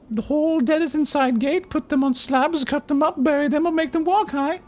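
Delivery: put-on very high, small girlish voice; girl of ten voice. Player voicing hooded Pox in a high girlish voice. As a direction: squeak it out like a ten-year-old girl. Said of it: "I'm doing a silly voice".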